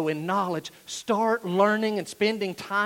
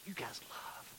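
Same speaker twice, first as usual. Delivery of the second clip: whispered, very soft; hushed tenderness; 3 semitones lower, 20 dB quieter, slower than usual. Acted. Paul gently affirming the Philippians' love, hushed whisper. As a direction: let it drop to a whisper.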